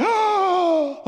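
loud, high gasp of panic; panicked gasp. Shown as a demonstration. Teen waking at night in a panic attack, panicked gasp. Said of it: "like this".

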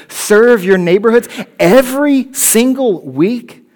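scoffing critic asking are you kidding me; mocking critic. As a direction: sneer at the churchgoer's devotion. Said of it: "And people see that and say".

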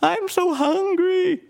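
high, slow, hammed-up whimper of hunger; fake-sob it way up high and drawn out; mock crying. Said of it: "Larry pretends to cry and goes".

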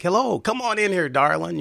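a flirty Southern Illinois welcome, voice lifted high; Southern Illinois accent. Retold or imitated. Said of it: "I tried to put on my Southern Illinois accent".

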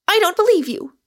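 high, loud protest; agitated outburst. A narrator's reading. Rosamond refuses to believe Michael's confession, agitated. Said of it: "not without agitation".

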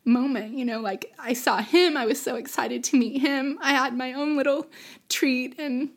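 fighting back tears while finishing the memory; tearful. Real, not acted. Guest recalling seeing her newborn brother, tearfully through real crying. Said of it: "I'm sorry for crying".

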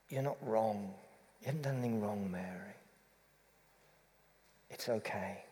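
soft, low reassurance; quiet words of grace, no rebuke; gentle. Retold or imitated. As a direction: reassure tenderly, easing off to very quiet. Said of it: "It's gentleness, not anger".

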